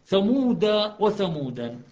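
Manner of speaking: ثمود said first without nunation, then ثمودا with a final alif, slowly; contrast two readings. Shown as a demonstration. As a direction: read ثمود, then ثمودا, stretched and loud. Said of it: "منجوك ومنجوك على المبالغة وعدم المبالغة". (نطق ثمود ثم ثمودا ببطء وبصوت عال)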